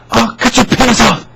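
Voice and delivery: shrill-pitched angry caller impression. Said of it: "like, angry".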